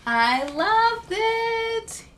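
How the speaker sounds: high, loud and drawn-out delight. Real, not acted. Reader telling children she loved the book, drawn-out delight. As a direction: gush over the story slowly. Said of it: "reader reacting to the story".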